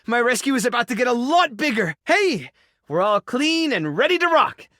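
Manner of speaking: high-pitched, bouncy enthusiasm; thrilled and raring to go; excited. Acted. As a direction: burst out with eager delight. Excited yes.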